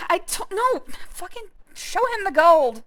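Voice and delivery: loud, slow exasperated shout; frustrated yell. Real, not acted. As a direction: yell it at the screen. Frustrated player yelling at game.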